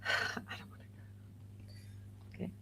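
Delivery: whispered reluctance. Retold or imitated. Reluctant grieving mother refusing tea.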